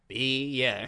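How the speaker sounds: drawn-out Australian "Beer", falling at the end; mimicked barman slowly confirming "Beer". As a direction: stretch Beer into two syllables, Aussie style. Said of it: "And he goes".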